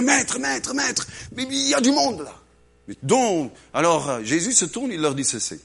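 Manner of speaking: ordinary delivery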